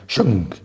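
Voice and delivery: mouthed whooshing sound effect; vocal sting for a sudden appearance; sudden reveal noise. Retold or imitated. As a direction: imitate something popping out of the dark. Imitates eyes suddenly appearing.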